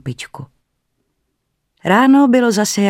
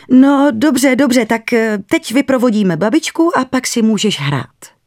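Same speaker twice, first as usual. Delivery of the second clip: higher, louder hesitant soothing; placating; 7 semitones higher, 5 dB louder than usual. A narrator's reading. Mother uneasily giving in to her son, high hesitant. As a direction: humour the boy while unsettled.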